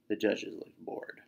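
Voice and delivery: slow whispered boredom. A narrator's reading. Narrator whispering how bored the judges are. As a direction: drag it out bored.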